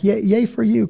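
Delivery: high, thin mock cheer. To the podcast host, mocking the other side's cheer. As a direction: fake a little celebration, then finger clicks. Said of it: "The other side would be like".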